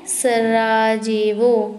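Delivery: each syllable drawn out slowly; teacher drilling a capital for the class, slowly; slow drilled repeat. Shown as a demonstration. Stretch every syllable. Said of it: "क्या है?".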